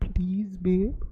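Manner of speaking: slow breathy whisper please. Pretend. Girlfriend whispering please to her babe.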